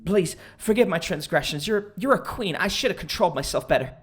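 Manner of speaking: hammed-up, pleading apology; pitiful begging. Man on his knees begs forgiveness, pitiful pleading. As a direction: grovel like a pitiful anime character. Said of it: "a pitiful anime character".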